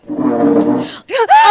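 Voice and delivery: sharp, loud gasp of horror; loud shocked gasp. Acted. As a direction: gasp as the coffee spills. Coffee spilled on Donna.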